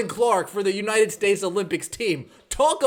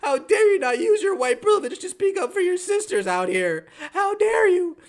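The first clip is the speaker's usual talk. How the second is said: mock furious yelling; 8 semitones higher than usual. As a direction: scold her in a shrill, mocking rage. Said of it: "Yelling at Kelsey Plum".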